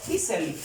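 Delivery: "easily" as EE-seh-lee, falling at the end; pronunciation model. Shown as a demonstration. Teacher showing learners how "easily" sounds, said EE-seh-lee. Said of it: "Y se pronuncia".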